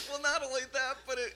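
talking through a high laugh. Real, not acted. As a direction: laugh as you start the line. Laughing into his reply.

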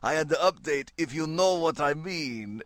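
put-on French accent drifting toward Count Dracula; bad French accent. French cartoonist hinting at a tryst, bad French accent. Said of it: "at what point does my French accent turn into Count Dracula?".

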